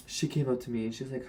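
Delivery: ordinary talk, nothing marked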